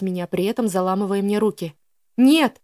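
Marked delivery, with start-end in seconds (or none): 2.2-2.6 a sharp cry, higher and louder; tearful cry. A narrator's reading. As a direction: cry out through tears. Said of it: "вскрикнула я, давясь слезами". (громкий вскрик сквозь слёзы)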